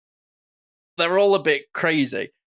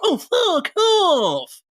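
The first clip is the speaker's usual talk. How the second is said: very high, long drawn-out exclamation; hammed-up comedian impression, loud and stretched; Lee Evans; 5 dB louder, much slower than usual. Shown as a demonstration. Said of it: "even Lee Evans as well".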